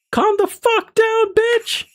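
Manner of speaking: put-on a panicked girl screams at herself to shut up; shrieking at herself. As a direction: lose it and scream at yourself to calm down.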